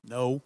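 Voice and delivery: a low, firm Texan-free "No." pressed out with weight; one flat emphatic "No.". Retold or imitated. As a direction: land "No." down hard and final. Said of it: "answer with an emphatic".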